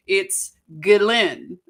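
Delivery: "Ghislaine" said slowly with a hard g and no s, as gee-LEN; careful "Ghislaine", hard g, silent s, stress at the end; French pronunciation of Ghislaine. Shown as a demonstration. Naming the defendant the French way for viewers, slow and careful. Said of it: "I'm gonna say her name right today".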